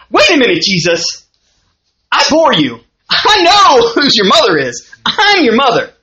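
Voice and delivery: lightly hammed-up indignant mother; mock indignation. Preacher voicing Mary answering her son's question, mock-indignant.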